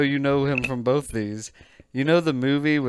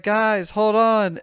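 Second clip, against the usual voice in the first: mock woman calling out; 7 semitones higher, slower than usual. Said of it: "And she's like".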